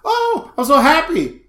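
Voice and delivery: high, loud fake happiness; hammed-up overjoyed squeal; mock delight. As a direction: gush it as put-on good news. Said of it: "you try to play the, the positive card".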